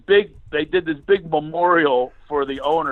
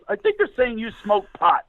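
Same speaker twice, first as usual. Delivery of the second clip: lightly voiced child, higher; a hint of a ten-year-old, pitched up; young son; 6 semitones higher than usual. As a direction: imitate an innocent boy's guess. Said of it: "my son goes".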